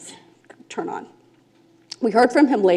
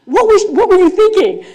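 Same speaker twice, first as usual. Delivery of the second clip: high, loud, pushing for the truth; girl overrules the facilitator, loud and high; insistent demand; 10 semitones higher, 8 dB louder than usual. Retold or imitated. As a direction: press him hard for an answer. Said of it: "And she said".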